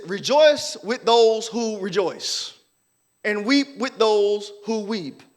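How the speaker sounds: slow, deliberate reading of the verse; slow scripture reading. Shown as a demonstration. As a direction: read the text slowly and weightily. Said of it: "Text says this".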